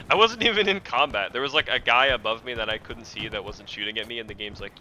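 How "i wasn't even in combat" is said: indignant outburst. Real, not acted. Indignant after laughing.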